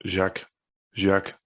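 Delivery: "Jacques" with a French zh and short a, repeated. Shown as a demonstration. Repeating "Jacques" after friend, French twang. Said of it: "you've got the French twang going on".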